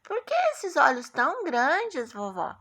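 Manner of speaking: high-pitched storybook question, dropping on "vovó". Acted. Chapeuzinho asking grandma about her big eyes, high and wondering. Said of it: "Aí a Chapeuzinho fala".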